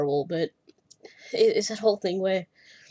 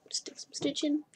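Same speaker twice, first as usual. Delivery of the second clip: whispering; 7 semitones higher, 8 dB quieter than usual. Real, not acted. Relaxed aside about a lazy afternoon.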